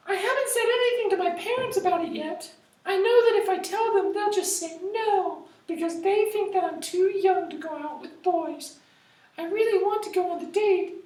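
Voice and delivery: put-on very high, girly teen voice; teen girl voice. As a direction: play a fretful teenage girl. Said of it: "do my best to sound like a teenager".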